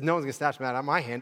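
quick, casual retelling of the verse; fast paraphrase. Shown as a demonstration. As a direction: rattle it off in everyday words. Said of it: "So Jesus says".

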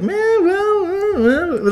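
wordless-sounding falsetto babble; high-pitched mock reaction. Retelling a reaction to what was heard, high mock voice. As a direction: squeak it out in falsetto.

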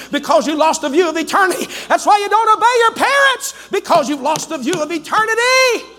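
building to a high, loud climax; full-force preaching. Real, not acted. Preacher rebuking those who lost the view, rising shout. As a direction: drive the accusation up to a shout.

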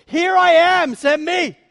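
a loud, high, bold call to serve; volunteer eagerly, full voice; eager offer. Acted. Isaiah before the Holy One, boldly volunteering loud. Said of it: "when he stands before the Holy One".

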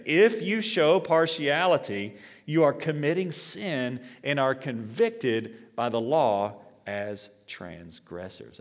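slow, weighty warning; warning the church against partiality, slowly; slow solemn warning. Real, not acted. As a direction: let each word land slowly. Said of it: "say this".

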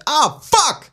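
high, loud cursing crab; swearing mudcrab. Acted. Showing friends how modded mudcrabs curse when hit, high loud yelp. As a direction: yelp the curse in a mock creature voice. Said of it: "Kun ne on vaan silleen".

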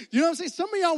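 usual speaking voice

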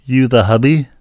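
loud, blunt mock question; imitating blunt lady. Lady turns to husband, imitated blunt loud question. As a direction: quote her loudly, like an interrogator. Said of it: "and I quote".

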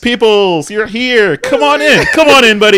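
high, loud, gushing welcome; mock party host. Rich host welcoming the coke dealer, loud and high. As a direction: greet the guest like an overeager rich host.

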